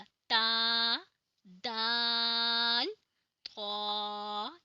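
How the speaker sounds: ता, दाल, तौ loud and clear from the tooth ridge; teacher showing the shared articulation; tongue-at-gum letters. Shown as a demonstration. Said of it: "ये तीनों एक जगह से अदा होते हैं".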